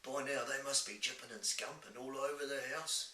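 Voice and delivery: big friendly giant with odd, homely speech. Acted. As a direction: play a kindly giant fretting over a child. Voices the giant.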